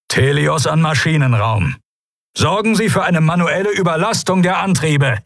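high-pitched firm command; commanding order. Acted. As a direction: give the engine room an order, pitch up. Admiral orders engine room by intercom.

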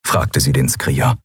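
plain reading voice

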